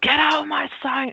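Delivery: grumpy mock yell; grumpy Beast. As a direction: play the Beast ordering someone out, mock-grumpy. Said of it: "being an absolute grump".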